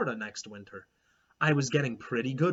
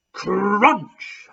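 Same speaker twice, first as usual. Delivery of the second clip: loud, higher crunch shouted out; yelled; 7 semitones higher, 11 dB louder than usual. A narrator's reading. Clementine yells her crunch at the silent picnic, loud. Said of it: "I yelled in case anyone had missed it".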